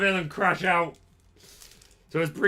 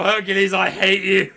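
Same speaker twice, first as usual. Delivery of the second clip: a mild mock yell at the card; playful outburst, a little louder; 5 semitones higher, 8 dB louder than usual. Shown as a demonstration. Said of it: "You just need to yell".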